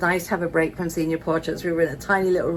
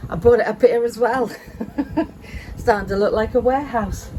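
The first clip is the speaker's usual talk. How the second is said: breathless after carrying boxes; puffing between words; out of breath; 4 semitones higher, 3 dB louder than usual. Real, not acted. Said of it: "I'm out of breath though".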